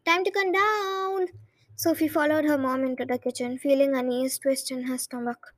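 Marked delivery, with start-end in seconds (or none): 0.1-1.3 raised, slow call upward; calling upstairs. A narrator's reading. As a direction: stretch it up the stairs. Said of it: "raising her voice so it would be heard".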